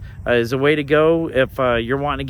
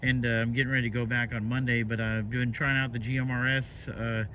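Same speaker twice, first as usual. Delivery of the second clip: walkie-talkie; 8 dB quieter than usual. Real, not acted. Radio contact recorded from walkie-talkie.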